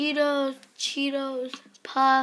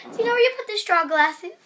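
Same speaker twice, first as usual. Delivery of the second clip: louder, higher, quick repeated question; calling out; 5 semitones higher, 8 dB louder, faster than usual. Real, not acted. Kid repeating a question to Mom, calling out. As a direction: raise your voice to be heard across the house.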